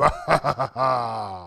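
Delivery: low, rolling ha-ha-ha; mock villain chuckle; triumphant laugh. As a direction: gloat with a long laugh. Winner gloats over rivals.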